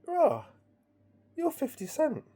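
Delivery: high-pitched astonished exclamation; amazed. Retold or imitated. Telling his mate who he is, amazed. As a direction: blurt it out high, mildly hammed-up disbelief.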